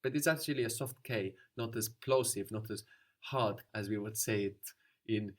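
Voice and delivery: ordinary talk, nothing marked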